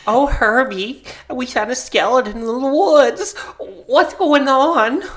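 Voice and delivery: high, laughing wife impression; mock wife. Wife asking Herbie about skeleton, mock-clueless singsong. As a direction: play the clueless wife, drawing out the end.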